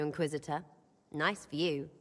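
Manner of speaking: ordinary delivery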